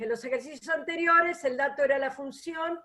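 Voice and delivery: fast, brisk recap read-through; quick reading. Real, not acted. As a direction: read it quickly, it has been said already. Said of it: "lo leo rápido porque es lo que dije".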